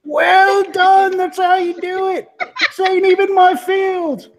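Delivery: high, laughing congratulation; cracking up while praising a teammate; laughing praise. Real, not acted.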